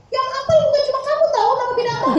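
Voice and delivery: high, loud retort as the wife; hammed-up angry comeback; mock quarrel shouting. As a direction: play a wife yelling back in a row. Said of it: "Istrinya juga gitu".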